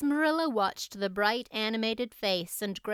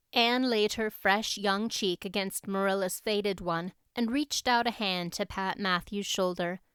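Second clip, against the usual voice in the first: ordinary delivery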